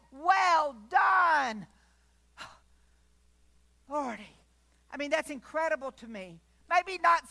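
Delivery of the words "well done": louder, higher shouted well done; triumphant shout. Acted. God praising the faithful servant, triumphant shout. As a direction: proclaim "Well done" as a loud cry. Eyes and say.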